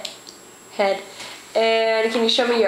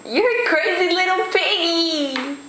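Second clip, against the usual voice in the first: playful teasing; 6 semitones higher than usual. Real, not acted. Parent teasing toddler at mealtime.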